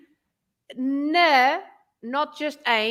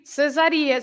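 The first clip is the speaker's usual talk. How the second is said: ordinary talk, nothing marked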